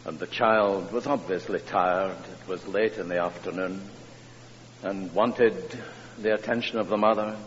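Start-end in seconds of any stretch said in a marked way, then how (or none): none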